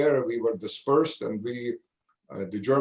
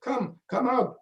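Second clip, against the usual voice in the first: higher-pitched mock calling out to a friend; retold excited yelling; 7 semitones higher than usual. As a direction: call him out of the outhouse, half-yelling with joy. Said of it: "they were yelling at me".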